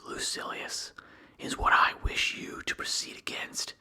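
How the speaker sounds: plain reading voice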